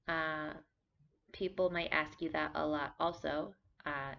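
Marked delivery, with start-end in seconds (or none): none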